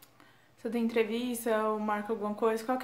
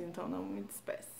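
ordinary talk

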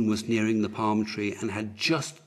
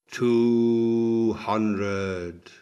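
long drawn-out, louder call; sustained, intoned number; slow countdown; 6 dB louder than usual. A narrator's reading. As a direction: stretch each word like a solemn toll. Physician counts down to death.

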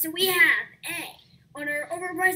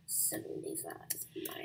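hushed off-mic counting; whispered numbers; 20 dB quieter, faster than usual. Real, not acted.

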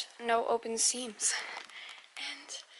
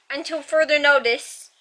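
usual speaking voice

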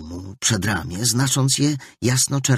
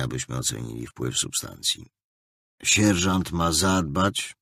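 stern captain; 5 semitones lower than usual. A narrator's reading. The captain instructs the prisoner healer, low and stern. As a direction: lay down the rules flatly and coldly.

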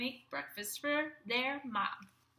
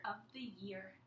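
soft unvoiced wonder; awed whisper; 4 semitones lower, 11 dB quieter than usual. Kids whispering at mom's appearance. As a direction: breathe it in awe.

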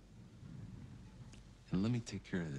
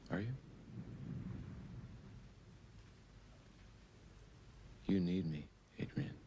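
low menace; 4 semitones lower, 6 dB quieter, faster than usual. Acted.

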